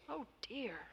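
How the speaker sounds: sighing. A narrator's reading. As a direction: let it out as a quiet sigh. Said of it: "sighed God".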